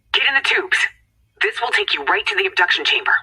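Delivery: ordinary talk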